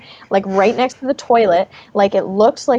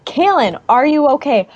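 higher, more insistent check on a friend; mock panic, pitch raised on each word; freaking out; 5 semitones higher, 3 dB louder than usual. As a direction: act out growing alarm. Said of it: "I start freaking out".

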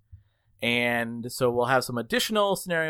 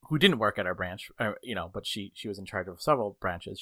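usual speaking voice

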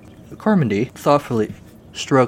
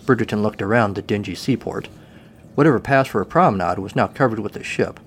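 usual speaking voice

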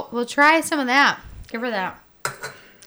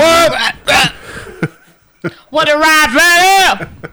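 [name]-style shout; 2 semitones higher, 5 dB louder, much slower than usual. Pretend.

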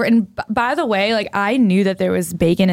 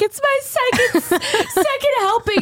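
very high, stretched-out fake wail; mock bawling; 13 semitones higher, slower than usual. Friend confesses to second helping, hammed-up crying. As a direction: wail it slowly in teasing imitation. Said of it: "bawling at the dinner table".

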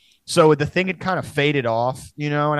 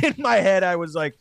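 ordinary talk

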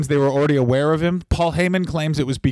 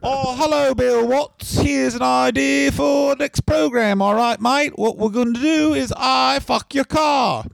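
higher, slow hammy Scouse voice; bad Liverpool accent impression; 11 semitones higher, slower than usual. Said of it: "to a Liverpool at a certain point".

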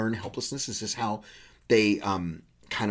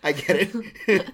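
laughing; 8 semitones higher, 3 dB louder, slower than usual. Real, not acted. Both laughing.

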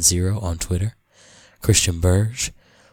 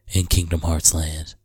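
ordinary talk

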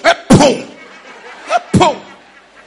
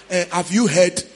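mock gossip; 8 semitones lower, 4 dB quieter than usual. Gossiper voiced.

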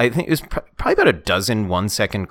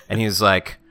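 ordinary delivery

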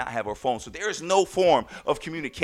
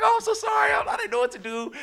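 much higher mock apology; sorry sister. Sister apologizing to her brother, mock high-pitched. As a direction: squeak out a sheepish sorry. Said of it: "And she's like".

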